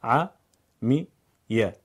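"عمیه" split very slowly, a-mi-ye; syllable by syllable. Shown as a demonstration. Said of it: "هجا هجا بخونید".

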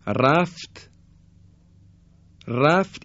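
رفت with a thick, heavy ر; heavy r demo. Shown as a demonstration. Teacher showing students the heavy ر in رفت. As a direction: say رفت with the ر made heavy and full.

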